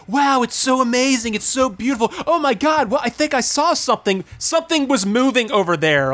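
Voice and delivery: high, gushing airhead wonder; mock awed girls. Mocking the divers in the cave, high and gushing. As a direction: ham up a girl amazed underwater. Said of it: "they're just like".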